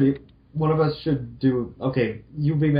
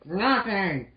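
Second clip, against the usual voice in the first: lightly voiced Mega Man; 10 semitones higher than usual. Roll's voice.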